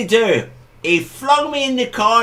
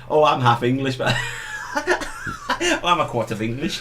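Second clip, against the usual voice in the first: mock quote through laughter; 6 semitones lower, 6 dB quieter than usual. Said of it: "ever hear anybody in Scotland say".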